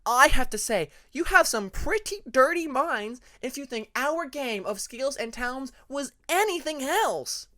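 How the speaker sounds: hammed-up offended, high and loud; mock scandalized protest to her friends; mock hurt. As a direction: play wounded innocence, slowly. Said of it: "mock hurt tone still present in her voice".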